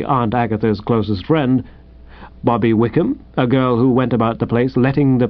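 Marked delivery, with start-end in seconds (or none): none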